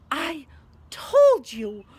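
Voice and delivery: breathless, broken words with a loud burst; gasping. A narrator's reading. Hermione to Harry, clutching a stitch, gasping reproach. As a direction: gasp it out between breaths. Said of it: "Hermione gasped".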